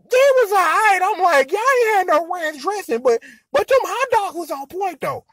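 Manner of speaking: high-pitched put-on voice; second voiced guest. Guest grumbling about missing ranch dressing, high voiced guest. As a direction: pitch it well up for a grudging food critic.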